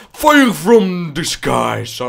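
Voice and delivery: slow, high, loud epic trailer line; mock epic announcement; dramatic movie voice. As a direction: ham up a big movie quote.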